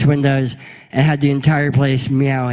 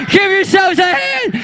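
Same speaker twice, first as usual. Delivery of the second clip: calling out. Real, not acted. Cheering volunteers after the game.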